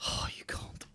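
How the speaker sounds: whisper. Real, not acted. Muttering at the game.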